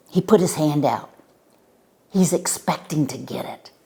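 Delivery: ordinary delivery, nothing marked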